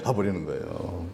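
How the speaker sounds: soft trailing echo; quiet echo. Real, not acted. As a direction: let it fall away under the breath. Repeated line, quiet echo.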